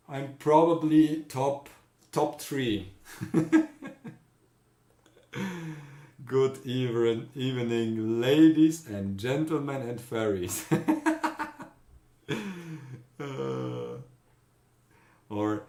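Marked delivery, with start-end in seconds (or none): 3.2-4.1 high laugh. Real, not acted. Laughs at own boast. 10.7-11.6 high laugh. Real, not acted. Laughs at own greeting.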